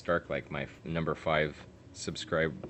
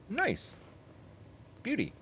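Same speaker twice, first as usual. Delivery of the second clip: excited; 4 dB quieter than usual. Real, not acted. Reacting to badge, high pitch.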